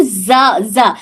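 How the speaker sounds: slow زا said like a plain z; wrong sound demo. Shown as a demonstration. Teacher showing the mistake. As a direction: say زا with a buzzing z. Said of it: "زال کو غلط پڑھ لیں گے تو".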